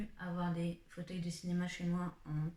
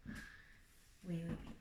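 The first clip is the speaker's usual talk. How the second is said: usual speaking voice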